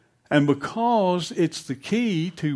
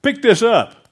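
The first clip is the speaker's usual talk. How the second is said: loud, high military bark; shouted order; 9 semitones higher, 11 dB louder than usual. Acted. Soldier ordering the farmer to lift the pack, shouted order. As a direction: bellow it as a command.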